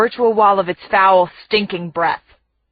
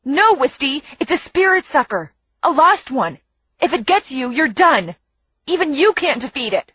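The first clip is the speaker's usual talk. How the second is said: urgent, higher-pitched warning; urgent whisper; 6 semitones higher than usual. A narrator's reading. Friend warning her about the creature from behind, urgently. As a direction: warn urgently in a raised pitch. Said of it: "Byron's voice urgently whispers from behind".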